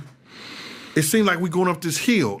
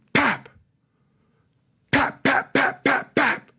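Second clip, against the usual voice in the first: gunshot noises; 10 semitones higher, slower than usual. Retold or imitated. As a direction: mouth gunshots, pap pap pap. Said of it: "We hear something say".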